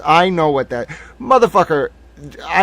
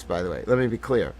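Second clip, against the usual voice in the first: ordinary talk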